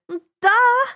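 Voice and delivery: high, loud exasperated Duh; exasperated cry. Acted. Annabeth answering Percy's obvious remark, loud and exasperated. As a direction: snap it out, fed up with the obvious. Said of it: "Annabeth cried".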